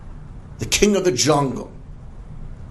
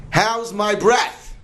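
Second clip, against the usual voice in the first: high, drawn-out challenge; demanding lion; 11 semitones higher, 3 dB louder, slower than usual. Acted. Lion confronting the fox about his breath, demanding. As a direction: stretch it out high, rising at the end.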